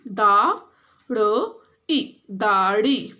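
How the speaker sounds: दाढ़ी drawn out very slowly, then said again; teacher modelling दाढ़ी; slow model reading. Shown as a demonstration. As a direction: stretch दाढ़ी for pupils to copy. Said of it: "you have to read and then you have to repeat".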